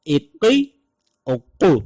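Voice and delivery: اقی and اقو with the qaf held double; doubled thick qaf. Shown as a demonstration. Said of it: "جب شد آتی ہے تو اس کی آواز ہے".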